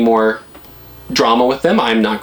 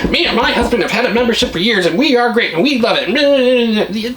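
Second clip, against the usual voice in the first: much higher, sing-song mock cheerful voice; happy timeshare wife; 11 semitones higher than usual. Mocking a viewer who loves her membership, high sweet parody. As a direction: parody a contented timeshare owner. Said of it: "someone out there that's probably".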